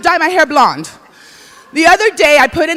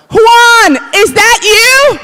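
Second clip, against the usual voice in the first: surprised call; 8 semitones higher, 6 dB louder, much slower than usual. Retold or imitated. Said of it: "this guy runs across the dance floor".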